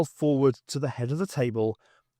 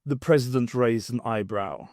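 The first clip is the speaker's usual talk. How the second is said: plain reading voice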